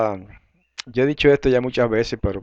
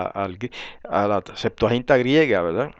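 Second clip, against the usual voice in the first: normal talking voice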